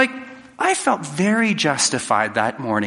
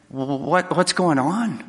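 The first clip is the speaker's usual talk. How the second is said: stammered, higher-pitched mock bewilderment; bewildered; 3 semitones higher, much slower than usual. Person ambushed by a partner at the door, mock bewildered. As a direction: act caught completely off guard.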